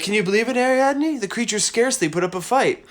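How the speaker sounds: lightly played proud hero gloating to Ariadne; hint of a cocky victor; boastful Theseus. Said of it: "and he's like".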